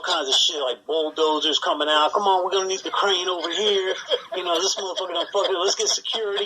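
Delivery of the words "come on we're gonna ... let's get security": higher, quick foreman barking orders; bossy spider foreman. Retold or imitated. Spider foreman ordering workers to clear the damage, higher and brisk. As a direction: boss the crew around as the spider.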